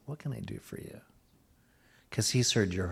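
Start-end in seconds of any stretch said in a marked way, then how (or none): 0.0-1.0 soft hushed offer, almost whispered. Shown as a demonstration. The Lord whispering to you, softly. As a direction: breathe it like a secret blessing. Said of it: "The Lord's whispering that to you".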